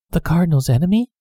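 quick, puzzled echo; a maid repeating in mild surprise; echoing question. A narrator's reading. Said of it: "Kitty echoed".

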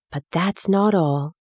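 ordinary delivery, nothing marked

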